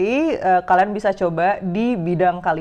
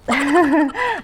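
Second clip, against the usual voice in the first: short laugh; 8 semitones higher than usual. Real, not acted. Laughs at own cheat sheet.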